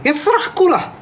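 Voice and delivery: high, snappy grumble in a put-on voice; high put-on snap, playfully cranky; mock snapping. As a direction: snap it out like a cranky Medan auntie. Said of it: "ini ngegas namanya".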